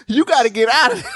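high, fast, laughing plea to get out; laughing warning. Retold or imitated. Fan pleading with [name]'s date to flee, laughing. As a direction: beg the character to run while laughing. Yelling at the screen, horror scene.

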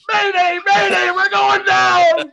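high, loud, slow distress call; mayday radio call. Pretend. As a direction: shout it like a crashing pilot. Mocking the pilot mayday clue.

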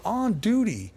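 disbelieving squeak on "On duty"; high, amazed "On duty". Real, not acted. Repeats in disbelief.

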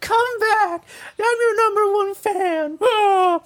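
very high, drawn-out wailing plea; mock hysterical meltdown. [name] begging her guests not to leave, mock hysterical. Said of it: "Laura just loses her fucking shit that they're leaving".

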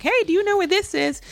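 high, bright call to a passerby; lightly voiced lost traveler asking directions; asking a stranger.

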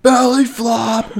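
high, slow, drawn-out retold yell; hammed-up slow shout; mock yell. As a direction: bellow it like you're mid-air about to land. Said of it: "I was like".

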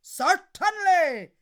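loud drawn-out giant yes; slow booming giant. Acted. Giant obliges Una, slow booming. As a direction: rumble it slowly.

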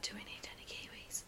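whispered, barely audible question; timid whisper. Retold or imitated. Nervous friend whispering in a busy shop, timid whisper. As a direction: ask as if afraid anyone hears. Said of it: "I would walk up to you and be like".

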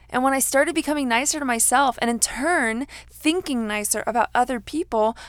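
usual speaking voice

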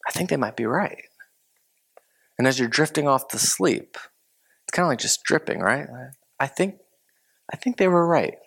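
soft, drifting admission; quiet dawning realization. Retold or imitated. Lying in bed, admitting the other was right, softly. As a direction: let it slip out as sleep comes.

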